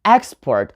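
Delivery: export as EX-port; noun stress. Shown as a demonstration. Teacher naming the noun, export stressed on EX. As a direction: hit EX in export, let port drop. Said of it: "then you have a noun".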